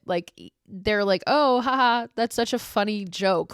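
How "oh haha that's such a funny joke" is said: higher, louder fake-amused imitation; mock laughing. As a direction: mimic their amusement with a spoken haha. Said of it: "they all kinda just, like, laugh about it".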